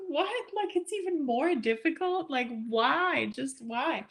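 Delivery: mock despair at harder characters; pleading "Just why?"; exasperated disbelief. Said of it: "and you're like".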